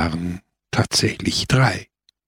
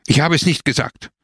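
higher-pitched insistent denial; shouting; 6 semitones higher, faster than usual. A narrator's reading. Biondo repeats his denial with pistol drawn, shouting. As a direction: insist sharply, voice raised in pitch. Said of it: "schrie Biondo".

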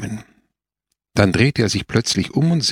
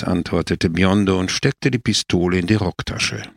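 plain reading voice

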